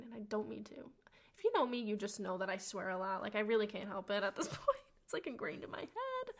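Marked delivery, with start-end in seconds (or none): 4.5-6.3 giggling through the words; laughing. Real, not acted. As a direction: laugh it off sheepishly. Laughing at her own swearing.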